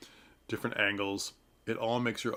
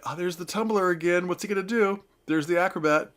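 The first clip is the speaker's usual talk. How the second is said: mock bored audience; 7 semitones higher, 5 dB louder, faster than usual. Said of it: "Was it just cheap, like".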